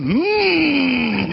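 put-on high whining toddler hum, sliding down; toddler noise imitation. Grandpa showing how his grandbaby goes, mock squeal. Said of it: "you've got Carmody going".

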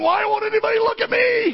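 very high plaintive little bird; whiny bird voice. Retold or imitated. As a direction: sulk like an ignored bird.

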